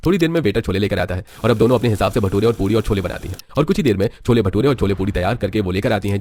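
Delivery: usual speaking voice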